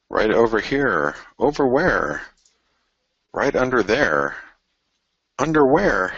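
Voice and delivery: high, goofy punchline voice; playing the "under where" gag, hammed-up; high, slow goofy voice setting up a pun; silly joke voice. Self-made joke, silly voices.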